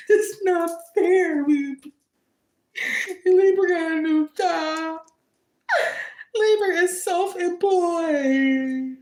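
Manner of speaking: hammed-up crybaby whine; mock jealous crying. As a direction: wail it like a jealous, sulking rival. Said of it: "Anyway, back in character".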